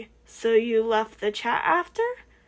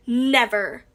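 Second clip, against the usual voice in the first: plain reading voice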